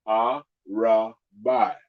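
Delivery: Arabai, very slow, syllable by syllable, a-ra-bai; broken into syllables. Shown as a demonstration. Teacher slowly breaking the word down for students. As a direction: stretch Arabai out piece by piece. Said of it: "break 'em up".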